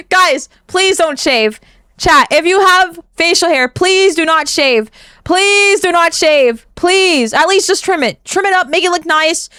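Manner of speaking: high-pitched, insistent begging; playful pleading. Real, not acted. Streamer begging chat not to shave, pleading. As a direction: beg them with comic desperation.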